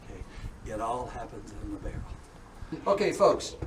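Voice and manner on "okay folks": normal talking voice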